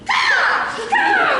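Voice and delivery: piercingly high, loud cawing; crow caw. Acted. Performer takes the crow's part, high shouted caws. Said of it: "I'll play the crow".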